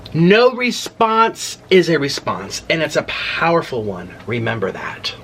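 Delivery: slow, drawn-out quote reading; dramatic read-out. Retold or imitated. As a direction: read it out slowly and weightily, then let it drop. Reads a message out for effect.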